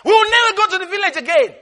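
high, indignant acted outburst; angry. Insulted siblings refusing to visit grandmother, high angry protest. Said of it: "the others will get angry".

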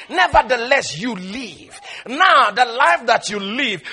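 normal talking voice

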